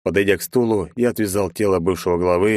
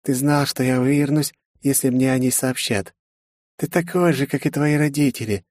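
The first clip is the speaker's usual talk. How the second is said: higher-pitched voice for a scornful woman; mocking villainess; 6 semitones higher than usual. A narrator's reading. Margaret taunts the hero mid-fight, scornful higher voice. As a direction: play the beaten yet taunting enemy.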